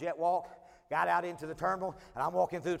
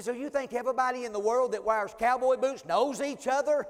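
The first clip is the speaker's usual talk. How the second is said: incredulous retort; 8 semitones higher, 6 dB louder than usual. Retold or imitated. Retorting to flight attendant.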